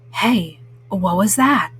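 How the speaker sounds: a cool cat pricking up his ears; Pete the Cat voice. Acted.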